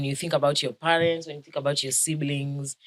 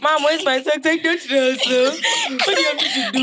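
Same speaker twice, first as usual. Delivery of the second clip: put-on high laughing kid voice; whiny kid then laughing mom; mock child and mom; 13 semitones higher, 6 dB louder than usual. Voicing child and mother, laughing.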